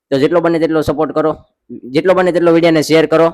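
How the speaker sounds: loud fundraising call to viewers; rally the audience to donate and share; charity appeal. Acted. Host urging viewers to donate and share, loud appeal.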